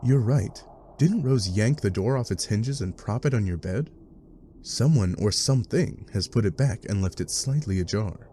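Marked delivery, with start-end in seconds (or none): none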